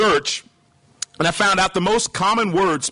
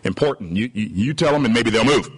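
lower, hesitant answer to a superior; deferential subordinate; 7 semitones lower than usual. Acted. Sailor nudging his captain to send the signal, deferential. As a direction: stammer a meek suggestion to the boss. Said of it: "He says".

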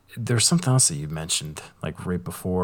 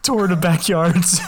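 laughing; 9 semitones higher, 3 dB louder, slower than usual. Real, not acted. Joking about backyard bike races.

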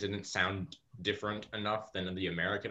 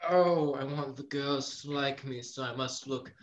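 foppish dandy; 5 semitones higher, 4 dB louder than usual. Acted. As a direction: play a vain fop fussing over looks. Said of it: "always be like".

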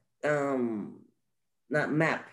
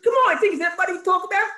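laughing; 5 dB louder, faster than usual. Real, not acted. High laughing protest.

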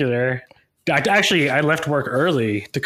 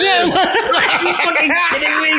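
laughing; 13 semitones higher than usual. Real, not acted. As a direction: crack up at a friend's blunder. Co-host's mic blunder cracks him up.

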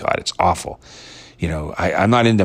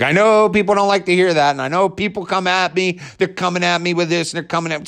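whiny impression, much higher pitched; mocking Dave Bryan; 10 semitones higher than usual. Podcaster mocks host whining that fans attack him. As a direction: mimic a self-pitying host complaining about fans. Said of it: "He says things like".

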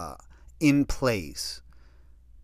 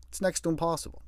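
raised repeat; 6 semitones higher, 5 dB quieter, faster than usual. Shown as a demonstration.

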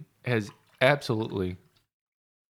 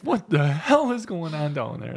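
nosy neighbors upstairs; 5 semitones higher, 3 dB louder than usual. Retold or imitated. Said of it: "they're upstairs right now going like".

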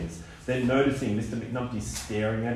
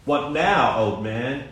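spat threat; 4 dB louder, slower than usual. A narrator's reading. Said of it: "the young thug spat".

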